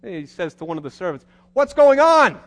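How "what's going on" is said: loud, high demanding call; father shouting a question to the servant. Acted. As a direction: call across the yard to a servant.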